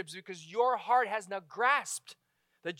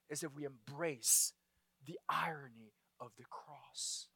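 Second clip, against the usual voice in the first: hushed whisper; 8 semitones lower, 13 dB quieter than usual. Real, not acted. Preacher whispers the key line to the congregation, hushed. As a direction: drop to a breathy whisper, barely voiced.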